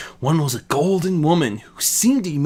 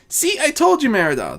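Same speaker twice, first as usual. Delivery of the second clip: higher, playful Azura voice; 12 semitones higher than usual. Acted. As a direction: tease her sister with a bright lilt. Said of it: "responded playfully".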